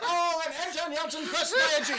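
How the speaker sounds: race caller. Pretend. Mock race call.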